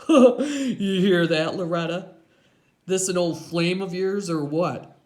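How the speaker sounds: loud belly chuckle into speech; big mocking biker, laughing as he talks; laugh, then tease her loudly; laughing Iggy. A narrator's reading. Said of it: "he starts to laugh".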